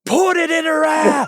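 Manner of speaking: high-pitched full shout; loud, high imitation of a heckling shout; retold heckler's yell. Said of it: "yells out".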